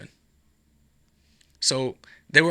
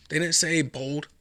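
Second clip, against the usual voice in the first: normal talking voice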